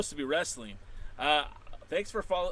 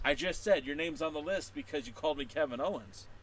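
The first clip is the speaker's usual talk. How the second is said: ordinary talk, nothing marked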